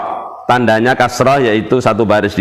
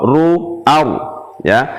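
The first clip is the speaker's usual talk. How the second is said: ordinary delivery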